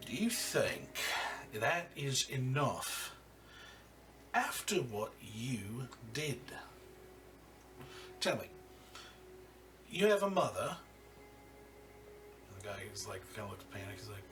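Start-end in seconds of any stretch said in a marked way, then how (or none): 0.1-10.8 cold boss interrogating a kneeling man; menacing crime boss. Acted. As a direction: lean in and threaten him slowly.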